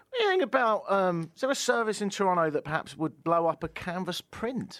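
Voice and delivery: bright high ad voice; hammy radio ad pitch; ad read. Acted. Ad read continues.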